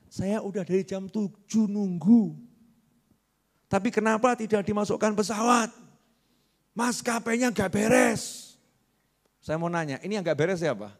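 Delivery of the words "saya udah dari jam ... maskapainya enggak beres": a lightly voiced passenger, mock complaint, not shouted; complaining passenger. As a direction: play the impatient traveler griping, half-hearted. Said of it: "terus teriak-teriak". (penumpang yang mengomel, pura-pura dan ringan)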